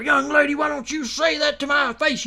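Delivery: hammed-up feisty old lady; imitating angry mama.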